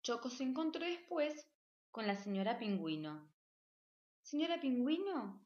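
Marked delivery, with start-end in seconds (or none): 4.2-5.4 higher, louder eager exclamation; a child bird excitedly calling the penguin; exclaiming. A narrator's reading. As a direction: call out to her eagerly, raised up high. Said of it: "exclamó".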